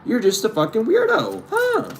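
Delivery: slow, drawn-out mock disbelief rising high at the end. Friend slowly realizing the truth, mock disbelief. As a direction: drag it out, then jump way up on huh. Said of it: "It's like".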